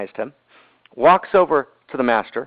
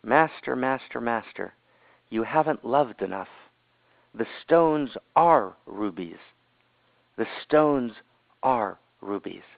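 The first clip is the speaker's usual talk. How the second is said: soft, slow, hushed urgent words; slow hushed confiding tone; hushed whisper; 4 semitones lower, 6 dB quieter, slower than usual. Retold or imitated. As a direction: breathe it into his ear like a secret. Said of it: "he whispered in my ear and he said".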